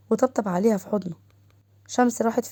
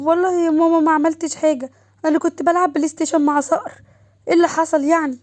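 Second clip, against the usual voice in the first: high, loud, whiny protest; aggrieved daughter's complaint, pitched up; protesting innocence; 7 semitones higher, 4 dB louder than usual. A narrator's reading. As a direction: plead innocence to an angry mother. Scolded daughter protests her innocence.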